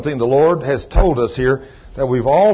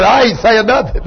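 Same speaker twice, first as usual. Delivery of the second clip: laughing quote; 5 semitones higher, 4 dB louder than usual. Retold or imitated. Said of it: "Keith said".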